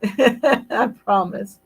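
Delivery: I promise, slow, through a laugh. Real, not acted. Host repeating her promise to a viewer, laughing slowly.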